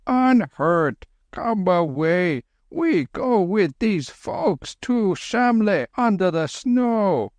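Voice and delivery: high, slow calling; slow high worried old monk; anxious Lama. Acted. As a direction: call out gently in the dark. Lama urging departure.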